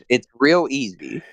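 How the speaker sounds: ordinary talk, nothing marked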